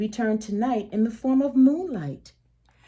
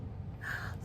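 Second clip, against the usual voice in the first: a hushed breathy gasp of wonder; quiet amazed intake of breath; soft gasp; 15 dB quieter than usual. Real, not acted. Reader reacts to shooting star.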